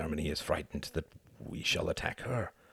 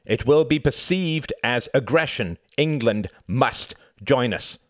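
higher, louder and very slow; emphatic; 8 semitones higher, 7 dB louder than usual. Acted. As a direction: stress every word firmly. Laval keeps insisting.

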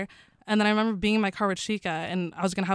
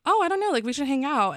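breezy eager reply; 6 semitones higher than usual. Retold or imitated. Comedian inviting her fans to hang out, high and keen. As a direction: pitch it way up, playing keen.

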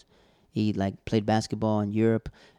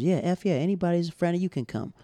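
excited; 7 semitones higher than usual. Retold or imitated. Host agreeing a friend can join Thanksgiving cards, delighted. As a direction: welcome him with big enthusiasm.

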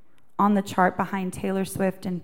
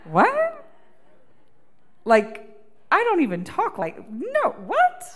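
high squeaky disbelief, louder; surprised; 9 semitones higher, 4 dB louder than usual. Real, not acted. Singer reacting to God's biblical phrasing, surprised. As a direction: yelp in disbelief.

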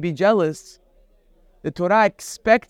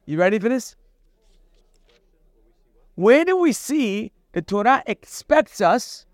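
ordinary talk, nothing marked

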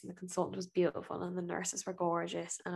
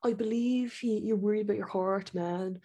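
put-on cocky young doctor; 3 semitones higher than usual. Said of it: "he stands there all cocky".

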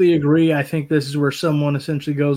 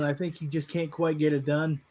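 ordinary talk, nothing marked

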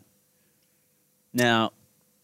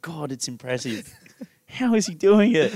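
higher, awed gawking bystander; amazed onlooker; 5 semitones higher, 3 dB louder than usual. Retold or imitated. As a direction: marvel at him, rising to a high astonished question. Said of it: "You'd be like".

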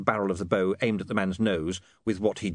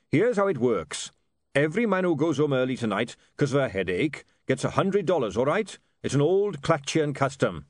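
lightly voiced, higher-pitched dwarf boss; dwarf laying down terms; 6 semitones higher, 3 dB louder than usual. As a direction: spell out the deal to the lads. Voices the dwarf addressing printers.

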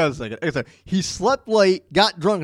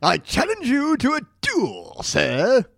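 mock gentleman; 5 semitones higher, slower than usual. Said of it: "And he was like".

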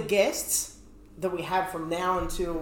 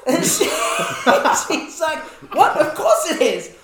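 laughing quote; 9 semitones higher, 8 dB louder, much slower than usual. Retold or imitated. Quoting an offended woman while laughing.

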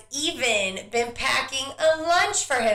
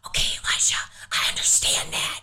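put-on urgent whisper to a noisy kid; stage whisper; 5 semitones higher than usual. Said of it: "I'm trying to whisper and tell him".

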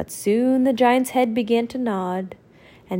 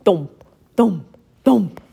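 heavy thumps; 2 semitones lower, 3 dB louder, slower than usual. Acted. Giant's footsteps approaching.

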